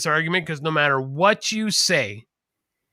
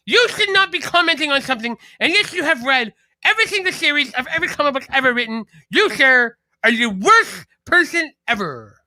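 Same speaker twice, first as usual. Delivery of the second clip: outraged comic nerd; 8 semitones higher than usual. Retold or imitated. The comic nerd scolding a fan online, high huffy outrage. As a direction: scold him like a huffy superfan. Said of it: "You doing a Casey impression right there?".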